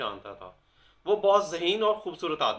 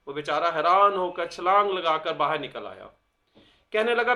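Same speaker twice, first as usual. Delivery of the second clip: usual speaking voice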